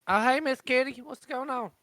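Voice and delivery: high, loud friendly greeting; high character voice. Acted. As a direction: pitch up for an NPC.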